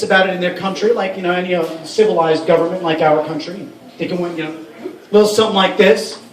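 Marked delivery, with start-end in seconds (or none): none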